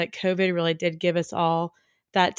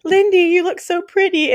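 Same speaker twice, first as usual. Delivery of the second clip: high, loud sugary compliment; imitated gushing fan; 11 semitones higher, 5 dB louder than usual. Imitating Instagram commenters to guest, mock gushing. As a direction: gush it in a pitched-up put-on voice.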